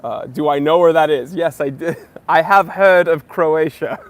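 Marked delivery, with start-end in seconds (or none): 2.3-4.1 laughing. Real, not acted. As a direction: laugh through the words, a bit louder. Laughing off a geography jab.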